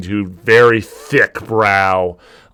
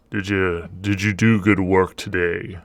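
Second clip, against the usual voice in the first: low quiet boss voice; 3 semitones lower, 7 dB quieter than usual. Acted.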